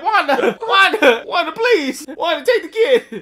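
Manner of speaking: high, loud laughing plea; loud pleading. Retold or imitated. Uncle begging Wanda to take the kid, loud high. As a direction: beg her loudly, voice up high.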